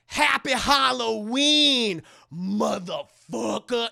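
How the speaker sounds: slow, loud badass farewell; slow parting one-liner. [name] leaving [name], slow loud parting line. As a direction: savor each word as the last line. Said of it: "one last line".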